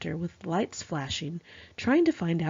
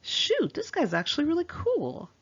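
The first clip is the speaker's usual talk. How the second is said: excited; 9 semitones higher than usual. Retold or imitated. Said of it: "I was like".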